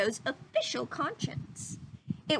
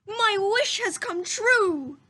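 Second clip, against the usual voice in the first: louder, higher, slow shout of joy; joyful shout; 8 semitones higher, 7 dB louder, slower than usual. A narrator's reading. Geppetto waking to a living Pinocchio, joyful shout. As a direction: shout it out in delight. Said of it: "he shouted".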